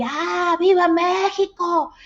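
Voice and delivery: high, drawn-out shout of a movie soldier; patriotic battle cry. Retold or imitated. Soldier in the old film shouting at the French, high slow yell. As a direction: belt it out like an old Mexican film hero. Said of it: "Grita así".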